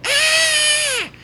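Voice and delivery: mother's shrieked "Ah!"; sudden high-pitched scream of comic horror; high mock scream. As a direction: scream like a terrified cartoon mom. Voices the screaming cartoon mother.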